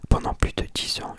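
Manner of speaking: normal talking voice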